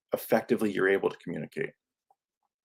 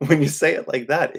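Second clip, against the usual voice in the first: chuckling, a bit higher; laughing repeat; 5 semitones higher, 4 dB louder than usual. Real, not acted. Host laughing at his own point, repeating it amused. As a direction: laugh through the repeated phrase.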